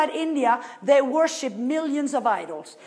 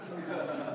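soft laugh; 7 semitones lower, 13 dB quieter than usual. Real, not acted. Laughing mid-story.

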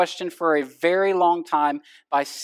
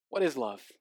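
softer spoken echo of the hook; quiet echo; 6 semitones lower, 10 dB quieter than usual. Retold or imitated. Pastor echoing the song question quietly to the congregation. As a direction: let the question drop off quietly. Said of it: "[name] summed it up all by asking".

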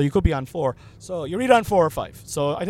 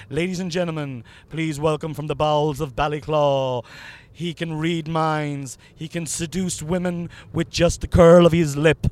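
hype man intro; 3 semitones higher, 6 dB louder than usual. Pretend. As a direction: build from announcer patter to a roaring stretched-out name call. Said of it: "You know, hype me up".

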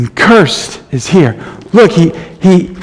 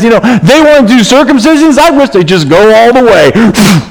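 higher, sarcastic retold anger; mock fury; 4 semitones higher than usual. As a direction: let Paul's exasperation bite, lightly. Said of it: "He's so mad at one point".

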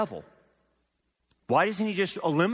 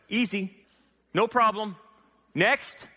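emphatic dismissal; 8 semitones higher than usual. Real, not acted. Preacher mocking God's easy victory.

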